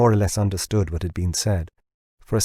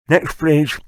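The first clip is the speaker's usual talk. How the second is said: garbled through a mouthful, higher and louder; 7 semitones higher, 5 dB louder than usual. A narrator's reading. James orders the next call with his mouth full, garbled. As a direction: talk through a bite of plum. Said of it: "he garbled".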